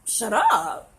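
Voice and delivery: loud, drawn-out offended disbelief; taken aback, stretching the words; incredulous echo. Retold or imitated. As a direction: throw it back as a stunned question. Echoes the snap back, offended.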